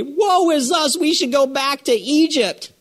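high, whiny lament; mock wailing Israelites. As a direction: wail it like a complaining crowd. Israelites wail in retold story.

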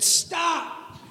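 high, drawn-out call; calling out stop. Retold or imitated. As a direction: halt them with a sudden high cry. Startled dad calls out stop.

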